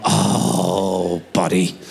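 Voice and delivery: a hint of a caught confession; guilty low oh buddy. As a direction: own up gently, low. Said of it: "I go".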